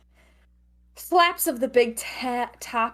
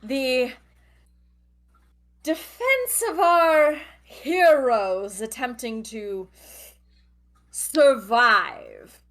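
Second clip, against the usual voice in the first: ringmaster announcing; 3 semitones higher, slower than usual. Acted.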